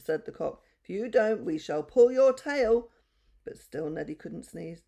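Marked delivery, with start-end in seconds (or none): 0.8-2.9 loud, slow threat; threatening. A narrator's reading. The cock threatens the donkey, loud and slow. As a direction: lean in and threaten slowly, loud.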